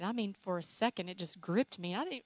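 ordinary talk

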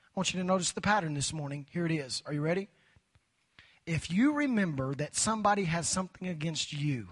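ordinary talk, nothing marked

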